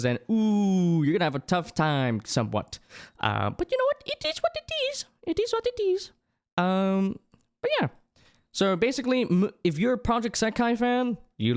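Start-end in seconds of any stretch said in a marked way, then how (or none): 3.5-7.9 squeaky shrug. Pretend. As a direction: shrug it off in a tiny cute voice.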